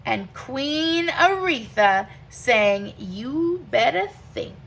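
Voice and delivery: rhythmic rhyming read-aloud; reading to children. Acted. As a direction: read it like a bedtime picture book, lilting. Reads picture book aloud.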